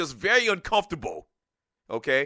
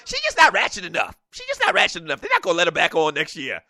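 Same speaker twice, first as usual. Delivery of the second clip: mocking wife's voice, pitched up; imitating wife; faster than usual. Husband imitates his wife on the show, mocking tone. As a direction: play the wife dismissing it, lightly mocking. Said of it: "that's exactly how she sounds".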